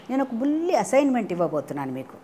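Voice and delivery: plain reading voice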